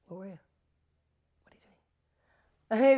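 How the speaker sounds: hushed, probing whisper; creepy whisper. Retold or imitated. Co-star questioning her in character, intense whisper. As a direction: breathe the questions out, slow and intense.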